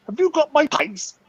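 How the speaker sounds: slow, high-pitched stern demand; mock-crazed stern questioning; really stern. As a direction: accuse like a crazy man, drawn out. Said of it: "really stern. I'm like".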